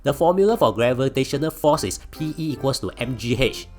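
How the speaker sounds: high, loud smug brat; cocky taunting. Acted. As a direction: show off to the teacher. Arrogant genius taunting teacher.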